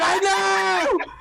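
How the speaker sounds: high-pitched mock cry; hammed-up high wail; exasperated agreement. Retelling own exasperated agreement.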